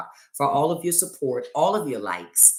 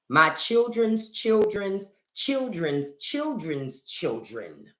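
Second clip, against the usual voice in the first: drawn out; 5 semitones higher, much slower than usual. Pretend. Comic stretched repetition.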